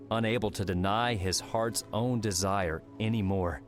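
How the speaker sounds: plain reading voice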